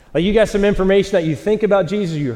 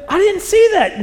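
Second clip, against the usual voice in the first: put-on much higher, doubting teen voice; girl's doubtful reply; 3 dB louder than usual. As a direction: play the puzzled girl, pitched way up. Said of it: "Rachel's retort in the retelling".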